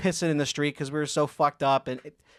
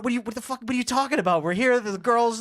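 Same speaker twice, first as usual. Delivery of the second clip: confused buddy; 8 semitones higher, faster than usual. Retold or imitated. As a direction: sell the buddy's disbelief. Buddy confused, protesting the exit.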